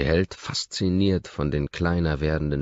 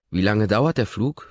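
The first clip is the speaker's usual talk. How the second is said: higher, louder eager question; excited question; 6 semitones higher, 5 dB louder than usual. A narrator's reading. Woman asks her pilot, a little excited. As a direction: ask it with a little thrill. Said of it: "fragt sie aufgeregt".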